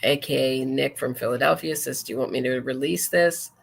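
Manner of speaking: ordinary talk, nothing marked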